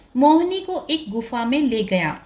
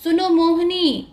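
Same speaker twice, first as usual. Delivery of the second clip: higher-pitched call to someone; raised calling voice; 7 semitones higher, 3 dB louder than usual. A narrator's reading. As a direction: call her to get her attention. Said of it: "उसे पुकारने लगा".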